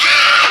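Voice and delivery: loud, high scream of pain; contraction scream. Retold or imitated. Woman gripping a pillow in labor, screaming in pain.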